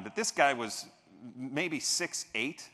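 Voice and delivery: ordinary talk, nothing marked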